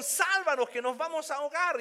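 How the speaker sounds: loud, high panicked shout; desperate cry. Acted. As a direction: cry out for rescue over the storm. Rereading the disciples' cry.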